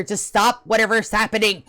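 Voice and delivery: ordinary delivery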